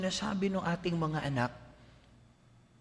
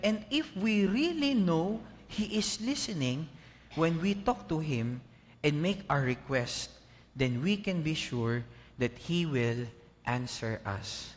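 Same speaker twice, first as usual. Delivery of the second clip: scripture reading; 2 semitones lower, slower than usual. Acted. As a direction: read the verse slowly, settling down. Reads Bible verse. (mabagal at taimtim na pagbasa ng Bibliya)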